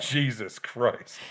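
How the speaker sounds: usual speaking voice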